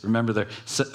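ordinary talk, nothing marked

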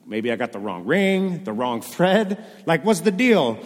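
building from puzzled to loud, high exasperation; starts unsure, ends in a loud bewildered cry; confused princess. Acted. Princess lost in goblin cave.

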